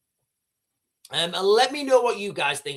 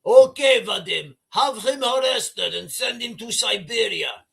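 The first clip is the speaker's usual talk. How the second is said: put-on loud, higher Russian-accent order; mock Russian Putin; 3 semitones higher, 3 dB louder than usual. Putin ordering his aide to arrest Alexei, in a hammed-up Russian accent. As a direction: bark the command in a hammy Russian accent. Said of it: "He's like".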